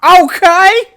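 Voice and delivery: startling mock shout; sudden shout. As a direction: burst out after long silence. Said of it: "quiet and silent for ages, and just".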